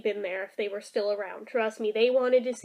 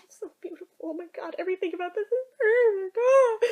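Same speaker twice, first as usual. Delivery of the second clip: mock weepy gushing; 7 semitones higher, faster than usual. Gushing about the perfect couple, high mock-tearful squeak. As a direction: squeak it out as if overcome by happy tears.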